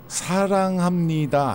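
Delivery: 사랑합니다 said slowly, drawn-out final 다; Japanese accent Korean. Shown as a demonstration. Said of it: "이응 발음이 안 돼요".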